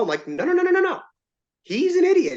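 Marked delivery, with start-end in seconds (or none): 0.3-1.0 high rapid insistent nos; emphatic refusal. Retold or imitated. Player refusing the official's advice, high emphatic nos. 1.6-2.3 high, louder heated insistence. Retold or imitated. Player arguing with the official, high heated insistence.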